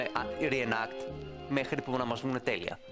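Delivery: normal talking voice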